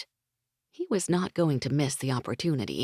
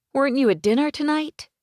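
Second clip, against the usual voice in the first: higher, louder, quick chiding; a quick, higher, louder chide; 10 semitones higher, 6 dB louder, faster than usual. Acted. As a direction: scold him playfully. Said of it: "she chided him".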